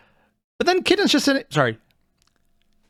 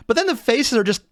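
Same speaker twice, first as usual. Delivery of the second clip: ordinary talk, nothing marked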